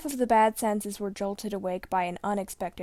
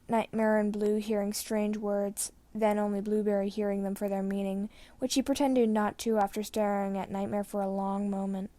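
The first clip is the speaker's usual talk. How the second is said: usual speaking voice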